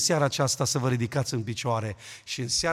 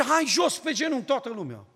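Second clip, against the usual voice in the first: high, loud command to kneel; old man's order; 9 semitones higher, 6 dB louder than usual. Retold or imitated. Old man ordering the family to kneel, loud command. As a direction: order everyone down. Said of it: "Și el zice".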